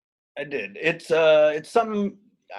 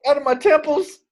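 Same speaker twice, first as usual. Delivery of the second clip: crying; 9 semitones higher, 6 dB louder than usual. Acted. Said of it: "you are crying really aggressively".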